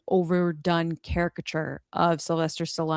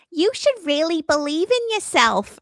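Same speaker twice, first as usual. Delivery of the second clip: a mocking, much higher earnest girlfriend voice; 10 semitones higher than usual. Mocking the trailer girlfriend's line to friends, sappy high voice. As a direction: parody the girlfriend's cheesy encouragement. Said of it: "his girlfriend's like".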